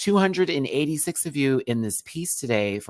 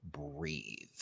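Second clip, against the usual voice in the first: dramatic whisper; 7 semitones lower, 18 dB quieter than usual. Acted. Host predicting [name] will love the queen, dramatic whisper. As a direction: breath-only whisper for effect.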